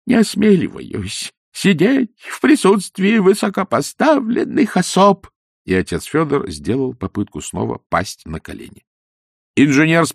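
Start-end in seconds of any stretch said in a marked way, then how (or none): 0.1-5.3 high, slow, drawn-out whine; grovelling priest. Acted. Priest refusing to sit before his betters. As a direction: fawn over the important people.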